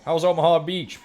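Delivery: a coworker's put-on Southern accent mocking his age; fake Southern accent. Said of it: "kind of a fake Southern accent he puts on".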